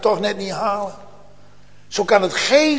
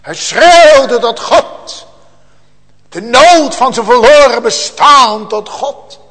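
loud, raised voice with drawn-out stressed words; fervent preaching cry; 4 semitones higher, 8 dB louder, slower than usual. Acted. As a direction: preach the desperate cry at full force. Preacher dramatizes Hezekiah's cry. (luid, bewogen uitroepend)

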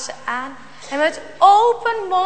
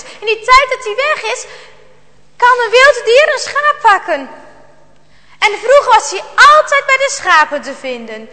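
father's voice, louder and higher, insisting; insistent father; 4 semitones higher, 7 dB louder than usual. A narrator's reading. Father tells Jarno the dog must go, insistent. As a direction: lay out the case firmly and loudly.

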